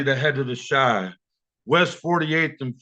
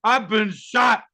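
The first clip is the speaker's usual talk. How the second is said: wounded man pleading; 6 semitones higher, slower than usual. Retold or imitated. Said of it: "looked me in the eye, and said".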